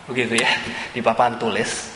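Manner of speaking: ordinary talk, nothing marked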